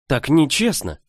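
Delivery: mild stamping-foot indignation; angry. A narrator's reading. Girl protests the unjust exile, mildly angry. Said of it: "Я со злостью топнула ногой".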